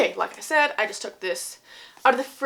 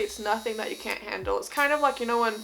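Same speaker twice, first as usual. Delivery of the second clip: usual speaking voice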